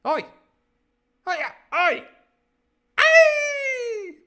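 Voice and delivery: high, loud repeated yells; escalating high yells with a drawn-out finish; mock screams. Shown as a demonstration. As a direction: scream out cries, ending on a long one. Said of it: "drawn-out scream".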